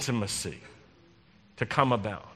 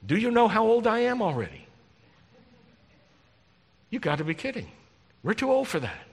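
put-on higher, fast, disbelieving old woman; mock incredulous Sarah; 6 semitones higher, faster than usual. Rabbi voicing Sarah, scoffing in disbelief. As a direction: play Sarah scoffing at the promise. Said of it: "what Sarah is saying".

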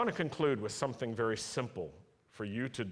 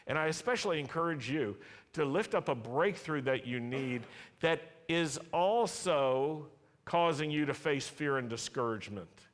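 ordinary talk

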